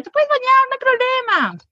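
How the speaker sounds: mock breezy nurse; lightly voiced nurse. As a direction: chirp it like an easygoing nurse. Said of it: "en el hospital me dijeron".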